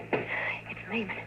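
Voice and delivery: slow, hushed warning whisper; urgent whisper. Acted. Kathy warning Don the killer is at the door, whispered. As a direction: breathe the name so he can't hear.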